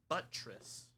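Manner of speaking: "buttress" with the first syllable punched; BUTT-ress, first half stressed. Shown as a demonstration. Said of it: "Emphasis on buttress".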